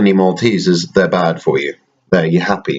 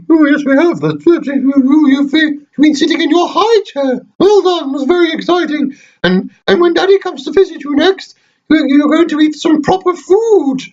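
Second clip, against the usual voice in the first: high squeaky toy voice, raised and bright; teddy voice. Pretend. Dad voicing teddy Toronto to his baby son, high toy voice. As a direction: squeak it out as the little bear.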